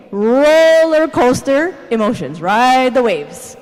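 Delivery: loud, drawn-out roller-coaster delivery; dramatic hype. Real, not acted. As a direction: stretch it out big and loud, riding the waves. Hyping up dramatic Bible characters.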